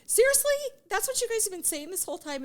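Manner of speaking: put-on high, loud, shocked scolding of the boys; mock outrage at the boys; retold shocked scolding; shocked disbelief. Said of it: "but I said".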